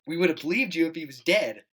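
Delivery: high, emphatic mock frustration; exasperated rant. Telling cousin they'd have believed him, exasperated.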